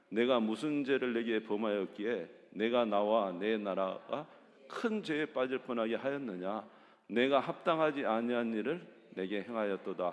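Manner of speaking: loud, steady group reading of the verse; scripture read aloud. Real, not acted. Leading the congregation in a loud scripture reading. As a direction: read it out together, firm and even.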